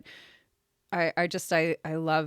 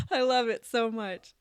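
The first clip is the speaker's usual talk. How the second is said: high, giggling and gushing; laughing gush; 5 semitones higher, 7 dB louder than usual. Real, not acted. Co-host to guest about the podcast, laughing gush. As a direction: laugh through the words with joy.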